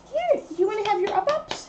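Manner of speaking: high, sing-song pet voice. Real, not acted. Talking to the dog Kiki.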